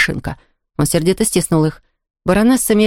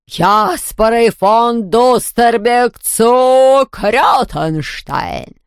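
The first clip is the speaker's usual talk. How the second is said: very slow, drawn-out villain voice; outraged ghost baroness; much slower than usual. A narrator's reading. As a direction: stretch every word in haughty outrage.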